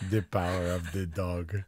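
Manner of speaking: vaguely European accent, hard to place; vague Euro accent. Shown as a demonstration. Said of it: "like a vague Euro accent".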